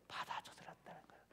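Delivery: hushed whisper for emphasis. Acted. Preacher whispers Moses took it all, hushed. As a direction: drop to a whisper on the key point.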